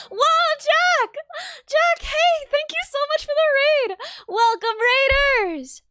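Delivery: high, loud burst of surprised delight; thrilled high-pitched welcome; excited raid greeting. Real, not acted. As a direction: exclaim as a friend suddenly turns up.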